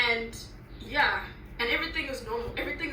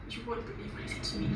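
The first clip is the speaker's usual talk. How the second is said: ordinary talk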